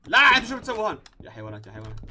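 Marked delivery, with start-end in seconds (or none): 0.1-1.0 2 sudden loud, high outburst; 3 yell at them as they wreck the shop; 4 a shopkeeper yelling at game customers who are messing up his shop; 1 shouting at customers. Real, not acted.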